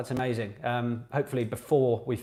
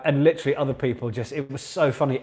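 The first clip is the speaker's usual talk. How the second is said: ordinary delivery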